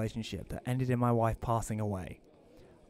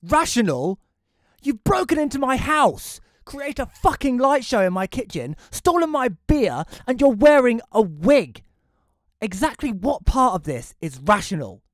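much higher and louder ranting; angry rant; 12 semitones higher, 10 dB louder than usual. Acted. Homeowner ranting at the intruder, high and loud. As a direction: rant at him in disbelief.

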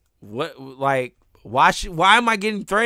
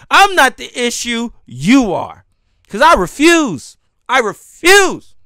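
loud, slow I refuse; defiant shout; 4 semitones higher, 8 dB louder, slower than usual. Real, not acted. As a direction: hammer each word, loud and defiant. Furious defiance at commenter.

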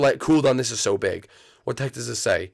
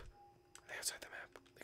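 whisper; 29 dB quieter than usual. Real, not acted. Hunting the hidden players, whispered. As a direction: whisper the question. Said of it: "whispers repeat of line".

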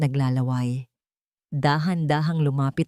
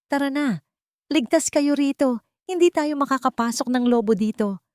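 eager welcome; 10 semitones higher than usual. Acted. Tomas welcomes his brothers.

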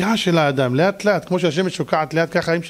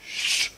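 hushing shush; 8 dB quieter, slower than usual. Retold or imitated. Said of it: "אני עושה להם, ששש".

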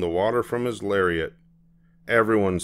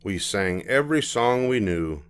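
usual speaking voice

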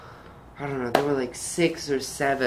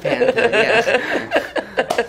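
laughing; 10 semitones higher, 7 dB louder, much slower than usual. Real, not acted. Laughing at her own slip.

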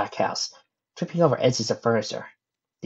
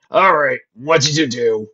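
loud, firm demand; 3 semitones higher, 8 dB louder than usual. A narrator's reading. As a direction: press them hard for an answer. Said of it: "demanded Stan".

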